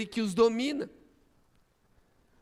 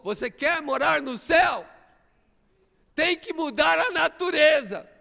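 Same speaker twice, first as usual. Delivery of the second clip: higher, louder, pressing the point; preacher raising the challenge louder; rising preacher's challenge; 6 semitones higher, 5 dB louder than usual. Real, not acted. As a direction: call it out to the congregation. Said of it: "repeated challenge, second take".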